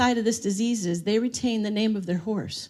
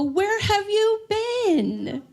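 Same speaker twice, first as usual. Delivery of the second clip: higher, very slow delighted welcome; delighted greeting; 9 semitones higher, much slower than usual. Acted. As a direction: greet a long-missed friend. Resident greeting a visitor.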